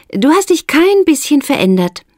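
challenging. A narrator's reading. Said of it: "mit deutlicher Herausforderung in der Stimme".

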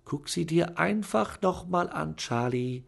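high, sugary coaxing; sweetly fluting. A narrator's reading. Aunt sweet-talking Charlie into looking again. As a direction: sweeten it to lure him in. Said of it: "flötete Tante Venetia".

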